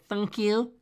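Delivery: short, curt brush-off thanks; staccato. Shown as a demonstration. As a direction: clip it short and dismissive. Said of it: "that short staccato".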